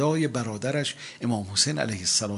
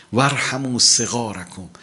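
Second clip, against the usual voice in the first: slow, measured hadith chant; solemn recitation; Arabic recitation; 5 dB louder than usual. Acted. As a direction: intone the Arabic slowly.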